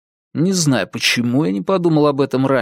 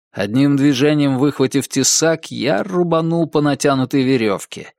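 ordinary delivery

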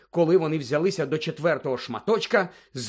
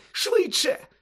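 very high frantic urging; breathless panic; 3 dB louder than usual. A narrator's reading. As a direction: hurry them, out of breath. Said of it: "ледве зводила подих Герміона". (задихано й панічно підганяє)

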